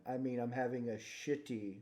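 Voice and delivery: ordinary talk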